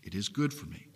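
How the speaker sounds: quiet echo. Acted. Preacher repeating to his congregation, softly.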